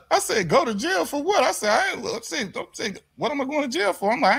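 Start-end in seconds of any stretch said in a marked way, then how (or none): 0.5-1.4 high-pitched retold disbelief; incredulous protest. Retelling his reply to the officer, incredulous. 1.7-4.1 high, baffled retold plea; incredulous protest. Retelling his answer to the officer, baffled protest.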